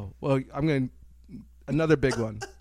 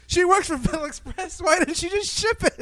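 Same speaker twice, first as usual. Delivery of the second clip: pitched way up, a mocking whine; high mock whine; 13 semitones higher than usual. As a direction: squeak it up high in mock complaint. Imitating a character's whine.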